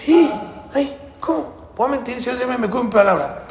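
high-pitched, scandalised gasp and protest; shocked protest. Acted. Honest man told he must lie, shocked protest. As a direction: protest as if appalled at the idea.

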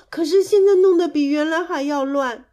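high, plaintive admission; regretful, high-pitched child; rueful confession. A narrator's reading. Ashamed child admits the bigger mess.

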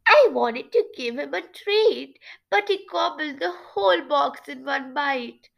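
high nasal storybook voice; Shivereen. Acted. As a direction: explain it high through the nose. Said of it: "trying to create her voice as nasal".

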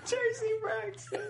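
high, slowed squeal through laughter; helpless laughing squeak; laughing to tears. Real, not acted. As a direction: choke out the name while cracking up. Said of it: "you're gonna make me cry".

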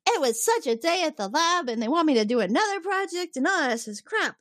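high-pitched mock griping; complaining. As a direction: whine about your day, imitating. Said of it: "immediately starts complaining about her day".

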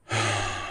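long, quiet deep sigh. A narrator's reading. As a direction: breathe out heavily. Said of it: "I sighed deeply".

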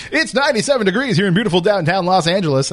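cheesy radio host read. Retold or imitated. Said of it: "Do you have a radio voice?".

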